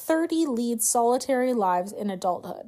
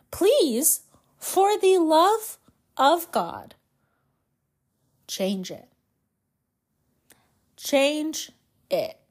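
begging, drawn-out for the love of God; half-joking plea to change the name; mock pleading; 3 semitones higher, slower than usual. Real, not acted.

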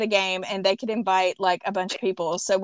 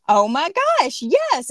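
excited; 6 semitones higher, 4 dB louder than usual. Retold or imitated. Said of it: "And I was like".

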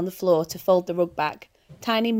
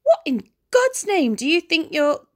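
much higher, louder, cut-off exclamation; outraged disbelief; 9 semitones higher, 5 dB louder than usual. Acted. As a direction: burst out in shocked indignation. Angel aghast at demon.

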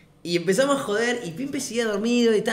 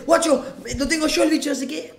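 higher mock alarm; raised, hammed-up cry; retold panicked yell; 7 semitones higher, 3 dB louder than usual. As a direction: imitate the friend joining the panic. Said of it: "lo mismo dice".

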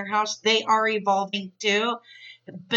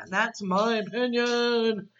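drawn-out, airy put-on voice; slow, breathy playful sign-off; playful declaration; 3 semitones higher than usual. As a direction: stretch it out, mock-grand and whispery. Jokey sign-off, said twice.

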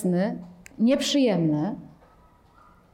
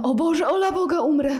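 mock panic; 6 semitones higher than usual. Teacher acts out the frightened mind, hammed-up panic. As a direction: ham up the terror of dying. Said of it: "to mówimy".

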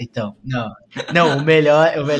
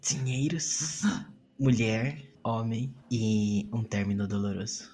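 soft voice; 7 semitones lower, 10 dB quieter, slower than usual. Acted. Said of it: "Vou falar baixinho aqui".

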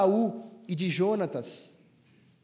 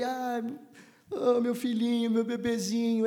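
put-on soft, tender baby talk to a little son; 4 dB quieter than usual. Pastor voicing a father cooing to his baby son, tender. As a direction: coo it gently like to a baby. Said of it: "que fala com ele com vozinha de criança".

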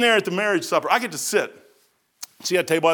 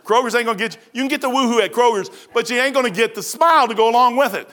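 usual speaking voice

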